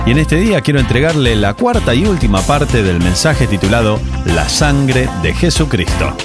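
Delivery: ordinary talk, nothing marked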